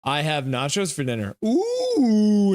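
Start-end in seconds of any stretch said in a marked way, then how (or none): 1.4-2.6 high, loud ooh; an eager ooh at the thought of food; delighted ooh. Real, not acted. Reacting to chat's dinner of nachos, delighted.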